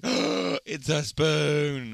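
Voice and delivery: drawn-out, hammed-up astonishment; very slow, awestruck reveal; mock amazed reveal. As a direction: stretch it right out in mock wonder. Acting out amazed reveal of spoon.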